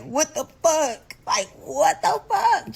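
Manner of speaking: disbelief. Retold or imitated. Teller reliving shock at the danger, slow disbelief. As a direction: stretch it out in utter disbelief.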